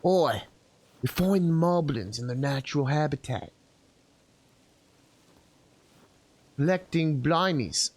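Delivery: hushed wildlife narrator voice; nature documentary. Pretend. Mock wildlife narration of moblins.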